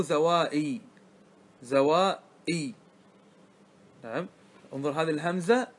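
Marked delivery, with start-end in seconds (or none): 0.0-2.7 "زوائي" slowly, in drawn-out syllables; sounding out. Shown as a demonstration. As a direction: stretch "زوائي" out slowly for students. Teacher sounds out intermediate word form. (نطق متمهل مع مدّ المقاطع)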